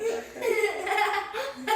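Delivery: high, drawn-out laughing reassurance. Real, not acted. Telling Cadence bald is beautiful, laughing. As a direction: laugh through the words as they rise.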